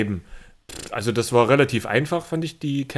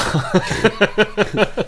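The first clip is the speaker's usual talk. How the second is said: laugh; 8 semitones higher than usual. Real, not acted. Laughing at the joke.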